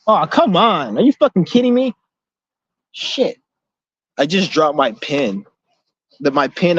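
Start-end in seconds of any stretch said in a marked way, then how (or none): 0.1-3.4 exasperated outburst, pitch jumping high; real frustration. Real, not acted. Dropping his pen in a puddle, exasperated high outburst. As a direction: blurt it out, fed up with the pen.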